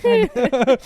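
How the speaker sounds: loud, high teasing repeat; teasing echo. Acted. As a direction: throw the word back mockingly. Echoing "kinda" loudly, teasing.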